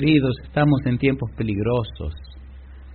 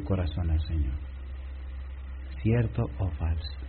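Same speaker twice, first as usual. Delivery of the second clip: soft, lower, intimate plea; gentle appeal; 4 semitones lower, 7 dB quieter than usual. Acted. Preacher softly urging the congregation to surrender. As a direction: draw them in quietly.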